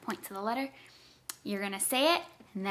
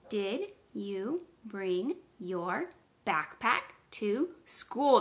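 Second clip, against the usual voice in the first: slow, every word apart; slower than usual. Shown as a demonstration. Teacher counts words with pupils, slow word by word. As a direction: gap after each word for counting. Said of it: "count how many words are in that sentence".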